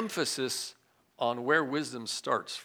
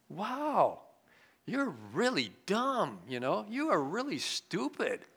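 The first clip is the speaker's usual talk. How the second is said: God mocking us; 4 semitones higher, slower than usual. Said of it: "He looks at us and go".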